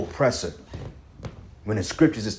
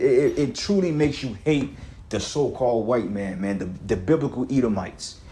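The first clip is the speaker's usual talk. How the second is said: normal talking voice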